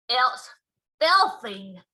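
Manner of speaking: loud spluttered words through a mouthful; spluttering, mouth full. Acted. Giant chewing the Snodscumber, spluttering with his mouth full. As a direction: spit the words out while chewing. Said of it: "he spluttered, speaking with his mouth full".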